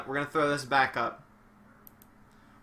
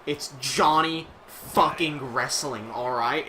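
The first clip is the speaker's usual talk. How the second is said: ordinary talk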